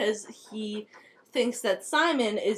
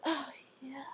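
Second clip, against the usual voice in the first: soft breathless whisper; creepy whisper; 13 dB quieter than usual. Acted. Clary relishing Jace stabbing himself, a whisper.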